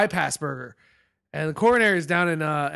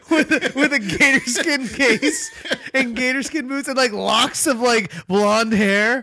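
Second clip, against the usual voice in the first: laughing; 6 semitones higher, slower than usual. Real, not acted. Laughing through Thor gator joke.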